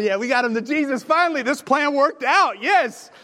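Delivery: excited cheer. Acted. Friends lowering paralyzed man to Jesus, jubilant cheer. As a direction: cheer like the plan finally worked.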